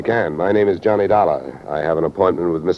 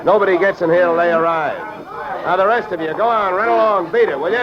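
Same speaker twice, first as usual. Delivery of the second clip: commanding orders; 12 semitones higher than usual. Acted. Taking command at crime scene.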